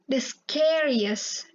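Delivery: slow, drawn-out the scariest with the A sound; careful English reading. Shown as a demonstration. Teacher reading the scariest slowly to her English class. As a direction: read the scariest slowly, stretching each sound. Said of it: "ada sound of A-nya gitu".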